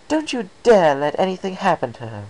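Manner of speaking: high, loud, fierce command; Rarity, fierce. A narrator's reading. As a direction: demand it hard, fearful for her friend. Rarity voiced in story.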